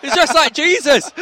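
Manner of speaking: high, loud and slow exclamation; excited. Real, not acted. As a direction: marvel at it out loud. Amused exclamation at waving fan.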